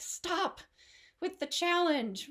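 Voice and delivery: high-pitched retold exasperation; mock fed-up outburst; exasperated protest. Retold exasperated reaction to nagging instructor.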